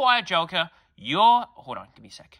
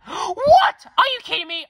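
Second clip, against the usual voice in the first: high, loud disbelieving shriek; father shocked by the news on the phone; shocked outburst; 8 dB louder than usual. Acted. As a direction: explode in outrage. Dad hears shocking news.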